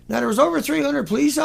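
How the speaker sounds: ordinary talk, nothing marked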